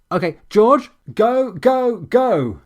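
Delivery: hammed-up shout of a swat leader into a radio; cod swat team shout. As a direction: overdo it like a movie commando. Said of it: "my cod swat team impression".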